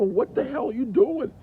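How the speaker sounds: put-on loud, high yell at a runaway kid; startled parent shouting; alarmed yell. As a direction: call out after the boy in panic. Son rides off toward street.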